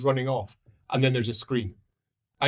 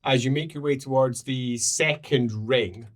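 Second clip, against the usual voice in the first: ordinary talk, nothing marked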